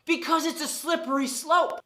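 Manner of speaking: ordinary talk, nothing marked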